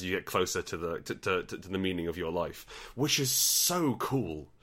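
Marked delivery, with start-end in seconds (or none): 2.9-4.5 slow, high, stretched "so cool"; delighted, lingering praise; gushing admiration. Real, not acted. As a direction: gush with a long drawn-out "so". Gushing over another designer's idea.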